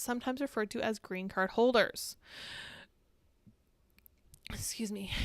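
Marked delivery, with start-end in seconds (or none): 4.5-5.1 whispered aside. Real, not acted. Aside after throat issue.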